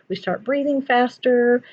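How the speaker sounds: ordinary talk, nothing marked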